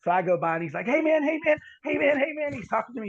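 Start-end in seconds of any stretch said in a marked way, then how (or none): 0.9-2.5 put-on high, excited call of a wild young man; mock crazy young inmate calling; acting crazy. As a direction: call out like a restless kid. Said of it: "He's acting like he's all, you know, crazy".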